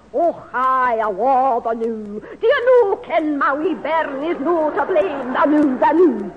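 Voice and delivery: loud, slow mock Scottish; hammed-up Scottish brogue; Scottish accent. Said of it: "It's a pity she's not Scotch like your granddad. I could do that".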